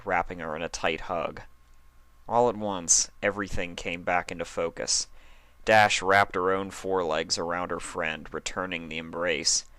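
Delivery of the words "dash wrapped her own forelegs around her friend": plain reading voice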